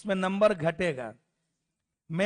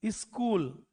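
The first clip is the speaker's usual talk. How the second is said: स्कूल as इस्कूल, softer; Bihar accent; 4 dB quieter, much slower than usual. Retold or imitated. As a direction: softly say इस्कूल with leading i. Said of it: "वो ई जोड़ता है सब चीजों में".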